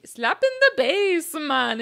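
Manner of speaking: high, slow, loud put-on movie voice; quoted movie character. Quoting the movie to his wife, in a high slow put-on voice. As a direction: drawl it out like the movie dude. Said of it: "what's the one".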